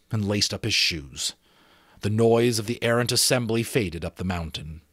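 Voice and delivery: plain reading voice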